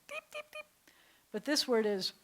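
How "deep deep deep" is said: "Deep, deep, deep" squeaked very high and soft; squeaky deep. Shown as a demonstration. Teacher squeaking the word very high and soft. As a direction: squeak it like a honked nose. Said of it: "sounds like you're squeaking somebody's nose".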